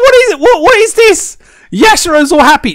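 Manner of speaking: can't get the words out for laughing; laughing disbelief. Real, not acted. Laughing at a fan design.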